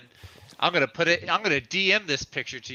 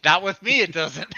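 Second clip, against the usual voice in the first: shouting, pitched up; loud; 6 semitones higher, 5 dB louder than usual. Real, not acted. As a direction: shout the refusal. Refusing the Canadian bit loudly.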